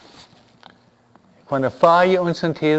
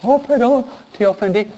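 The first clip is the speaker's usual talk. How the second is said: timid; 7 semitones higher than usual. Retold or imitated. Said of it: "muy tímido".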